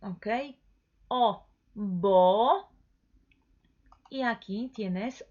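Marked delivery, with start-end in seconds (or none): 1.1-2.7 "O", then "bo", loud with a long pure o; emphatic, stretched "bo" after an isolated "O"; sound demonstration. Shown as a demonstration. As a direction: hold the vowel of "bo" loudly for learners. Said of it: "eso vamos a pronunciar como O".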